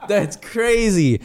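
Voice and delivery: amazed laugh. Real, not acted.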